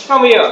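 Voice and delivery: "Come here" loud and short, as kamia; native linking. Shown as a demonstration. Teacher demonstrates again for students, fused "Come here". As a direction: snap "Come here" into one word. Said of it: "এরকম".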